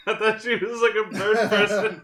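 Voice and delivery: high, loud laughing. Real, not acted. Laughing at own mistake.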